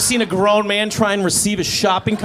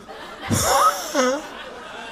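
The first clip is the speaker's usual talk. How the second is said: high startled squeal; hammed-up discovery squeak; squeal of surprise; 3 dB quieter than usual. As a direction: yelp like finding something in the dirt. Mimes discovering a carrot.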